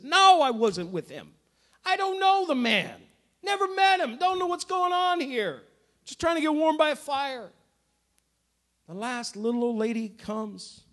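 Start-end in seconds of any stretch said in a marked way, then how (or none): 0.0-7.5 high, quick, flustered denial; frantic denial. Retold or imitated. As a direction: brush it off in a panic. Peter's frantic second denial.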